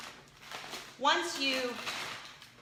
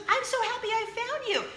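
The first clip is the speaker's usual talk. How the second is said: high-pitched, delighted reunion voice; gushing joy; 12 semitones higher than usual. Retold or imitated. Friend has found host again, gushing joy. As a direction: gush it like finding a lost friend.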